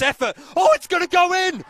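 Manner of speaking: rising into a full shout; goal call. Real, not acted. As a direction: build from eager to roaring. Commentator calls a long-range goal.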